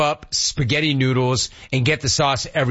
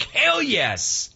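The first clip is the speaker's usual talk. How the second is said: hyped; 11 semitones higher than usual. Retold or imitated. Waking to see one thirty on the clock, hyped. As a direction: celebrate in a high, excited voice. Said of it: "I'm so hyped".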